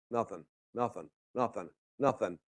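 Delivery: low, soft, evenly repeated reply; quiet mock reporting. Weather service answering the smart control hourly, quiet and low. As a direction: play a forecast murmuring the same uneventful update.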